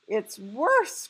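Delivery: loud, emphatic outcry; crying out. A narrator's reading. BFG answering Sophie's question, crying out. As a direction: punch it out in mild alarm. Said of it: "cried the B F G".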